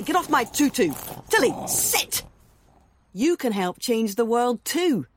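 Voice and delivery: usual speaking voice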